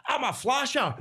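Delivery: high-pitched mock proclamation; hammed-up high reveal; gleeful announcement. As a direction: call it out high, like a kid showing off. Retold proud announcement to the circle.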